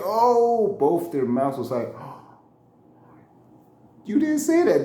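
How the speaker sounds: high loud gasping shock. Retold or imitated. The wife and boss reacting to the insult, shocked. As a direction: mouths dropping open in shock.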